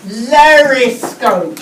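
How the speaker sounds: "Lariscope" said slowly and loudly, with a clear L; slow new rhyme. Shown as a demonstration. Teacher showing the class the made-up rhyme, slow and loud. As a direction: stretch out "Lariscope" to show the new first sound. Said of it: "A new rhyming word".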